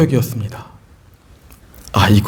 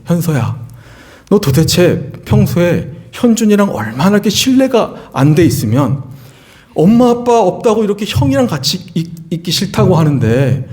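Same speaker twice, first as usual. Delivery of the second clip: put-on raised, higher exasperated telling-off of the older son; mock heated rebuke, pitched up; retold angry scolding; 6 semitones higher than usual. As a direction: scold him like a worked-up dad, pitch climbing. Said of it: "저는 막 흥분하고 화가 나서".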